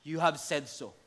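Jesus answering calmly. Acted.

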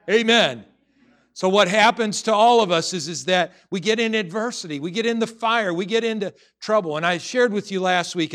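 loud, high, driving sermon voice; fiery preaching. Acted. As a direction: preach it out to the whole room. Preaching at full force.

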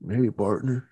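lightly put-on Western cowboy accent; a touch of Western accent. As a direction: greet them in a mild mock Western twang. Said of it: "Just do Western accents".

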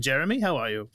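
normal talking voice

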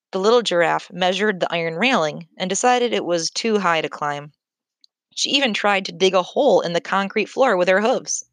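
ordinary delivery, nothing marked

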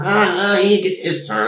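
mock Italian-American coach; Italian-American coach impression. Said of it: "That's how I used to talk, the Italian guy".

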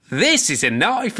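lightly voiced Crocodile Dundee, proud and amused; Crocodile Dundee boast. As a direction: lift This is a knife up high like unveiling a huge blade. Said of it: "and he goes".

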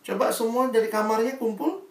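ordinary delivery

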